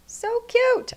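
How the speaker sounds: high gushing "So cute!" over a finished card; excited squeal. Real, not acted. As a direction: burst out "So cute!" in high delight. Bursts out after whispering it.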